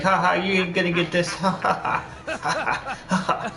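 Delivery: imitated taunting laugh, quick words first; mock laughing. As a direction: rattle off the taunt, then cackle in imitation. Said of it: "He's laughing at me".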